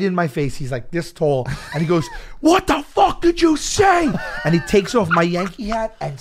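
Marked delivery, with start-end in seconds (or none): 2.4-4.1 high, slow, stretched-out confrontational shout; imitated angry yell. Wrestler right in the heckler's face, angry yelling. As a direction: play the enraged wrestler demanding an answer. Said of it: "while he's yelling at me".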